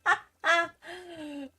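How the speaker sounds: mocking laugh. Real, not acted. Mocking the customer's line.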